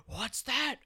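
high, gasping amazement; gasp, then stretch out the disbelief; hammed-up high-pitched gawking crowd; mock astonished onlookers. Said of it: "looking up at the hill going".

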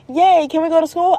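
put-on high, cheering child voice; imitated child's eager question; excited kid. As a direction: pipe up like a thrilled little kid.

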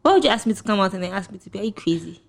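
ordinary delivery

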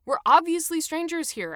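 frustrated outburst at the unfair fine; fed-up complaint rising into a loud objection. A narrator's reading. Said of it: "Adam said in frustration".

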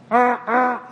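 high mock honk, uh uh; horn honk noise. Honking at the pickup truck, mock horn noise. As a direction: imitate a car horn with your voice.

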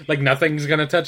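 laughing through the words. Real, not acted. Friend laughs while praising The Simpsons, laughing.

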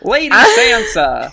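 slow, high, drawn-out impression; Littlefinger. Teasing with the character's catchphrase, comic impression. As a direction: stretch the name in a hammed-up character voice. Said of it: "Remember when Littlefinger was in that movie?".

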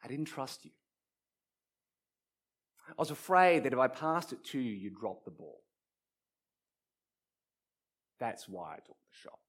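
soft, low, halting admission; quiet confession. Acted. Player admits to friend why he shot, quiet confession. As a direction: confess it softly, with long pauses. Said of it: "What I meant to say was".

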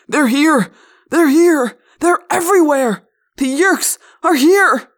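loud, high-pitched frantic yell; hoarse shouting. A narrator's reading. Wild-eyed man warns the crowd, shouting hoarsely. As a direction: shout a wild warning to everyone around. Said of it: "shouting in a loud, hoarse voice".

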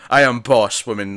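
loudly voiced domineering husband, lightly acted; mock bossy. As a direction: put on a loud boss-man assertion. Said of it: "That, that kind of mentality's in".

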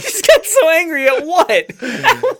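high, laughing teasing at a friend's rant; laughing hard. Real, not acted. Podcast host cracks up at his friend's sudden fake rage. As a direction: giggle through the words, barely holding it together.

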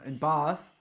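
normal talking voice

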